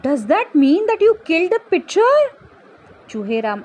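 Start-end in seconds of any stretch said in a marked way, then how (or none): 0.0-2.1 high, loud alarmed question; shocked question. Acted. Doctor asks pumpkin about killing pitcher, shocked. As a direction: ask aghast, pitch raised.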